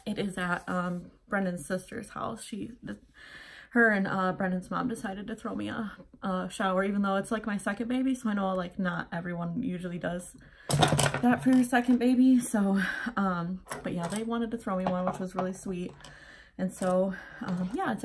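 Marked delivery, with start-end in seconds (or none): none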